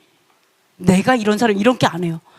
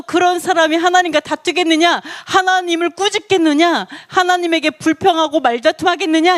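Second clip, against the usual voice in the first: pitched up, pressing rhetorical questions; challenging rebuke; 5 semitones higher than usual. Retold or imitated. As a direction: press each question as a reproof. Quotes God challenging the faultfinder. (높은 목소리로 따져 묻는 질책)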